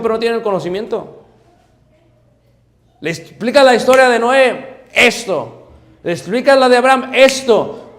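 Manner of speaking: high, loud, fervent sermon delivery; preaching at full force. Acted. Preaching to the congregation.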